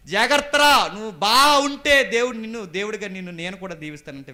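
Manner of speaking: high, forceful warning cry; loud retold warning. Preacher voicing the warning given to Cain, loud warning.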